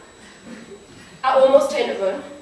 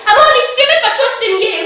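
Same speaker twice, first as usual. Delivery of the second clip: very high, loud shout; furious retort; 11 semitones higher, 11 dB louder, faster than usual. Acted. Girl shouts back at her attacker, high and furious. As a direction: hurl it back at full force.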